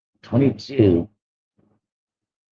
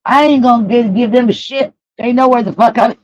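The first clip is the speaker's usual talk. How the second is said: high-pitched, indignant quoted outburst; retold yelling; 8 semitones higher than usual. Speaker re-enacting their outburst at partner, mildly yelled. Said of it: "I didn't even know I yelled at you".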